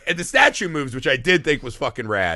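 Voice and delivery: ordinary talk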